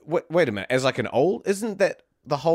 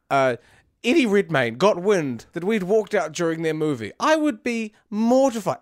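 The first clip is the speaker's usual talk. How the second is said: normal talking voice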